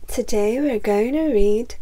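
ordinary delivery, nothing marked

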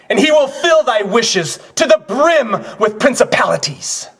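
high, ringing proclamation; projected stage oration, pitched high; proud declamation. Acted. Acted defiant line.

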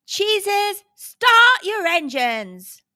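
high, loud race announcer call; booming announcer. A narrator's reading. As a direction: boom it out, stretching the words. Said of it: "the announcer boomed".

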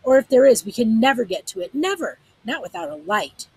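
loud shout sinking back down; shout, then let it drain away; shout fading. A narrator's reading. Sag to Lena, shouting then fading. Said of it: "His voice became a shout".

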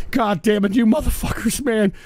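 normal talking voice

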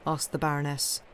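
plain reading voice

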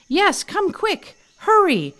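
high, loud, urgent cry; urgent shout. Acted. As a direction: hurry him along, almost breathless. Breathless Tom urging Ernest.

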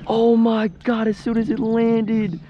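high, loud and slow, dragged out in disbelief; real stunned dismay. Real, not acted. Angler after losing a fish, slow high loud lament. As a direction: stretch it out, devastated.

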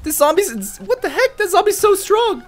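very high squealed protest; high-pitched game panic; alarmed disbelief. Real, not acted. Attacked by strong game zombie.

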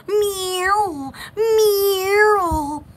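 long, drawn-out meows; cat call. Pretend. Joking about cat calling people, meowing. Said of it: "now you can cat call people".

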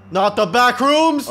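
loud, high, slow cry of dread; dramatic wail. Real, not acted. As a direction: wail it out in mock horror. Repeats it as mock horror shout.

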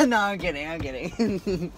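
light laughing take-back. Real, not acted. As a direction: laugh it off as a joke. Laughs off own joke to clerk.

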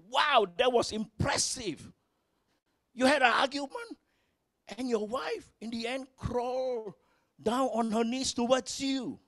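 high, excited voice of an impressed friend; lightly voiced awestruck friend; amazed friend. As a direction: gush like a buddy who can't believe it. Friend amazed in retold joke.

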